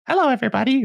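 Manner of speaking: very high, little sing-out greeting; cutesy town greeter. Retold or imitated. Receptionist greeting hunters in town, cutesy high voice. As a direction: put on a tiny high cheery voice. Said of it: "she's, like, a little".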